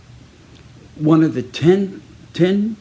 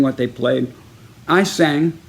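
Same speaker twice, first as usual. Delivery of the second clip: normal talking voice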